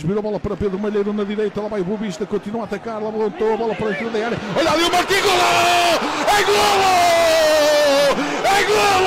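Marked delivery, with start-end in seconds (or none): 4.5-9.1 high, loud goal scream; fast dramatic football commentary. Real, not acted. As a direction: roar the goal call, stretching it. Second goal, commentator erupts.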